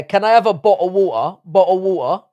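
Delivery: loud Cockney with glottal stops, bo'le of wa'er; mock London accent. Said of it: "That's exactly how we say it".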